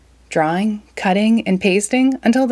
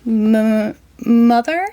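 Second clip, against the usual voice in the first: stammering; 4 semitones higher, 4 dB louder, much slower than usual. Acted.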